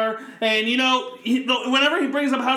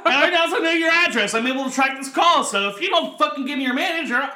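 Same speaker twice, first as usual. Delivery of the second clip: mock Karen rant; 5 semitones higher than usual. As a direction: rant like an entitled customer going off. Karen threat rant, escalating.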